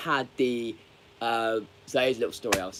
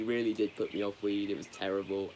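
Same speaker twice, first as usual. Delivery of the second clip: normal talking voice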